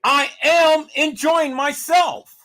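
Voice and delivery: imitating Kanika's words. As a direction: stretch each word out loud and high as if slurring a protest. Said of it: "when Kanika told you".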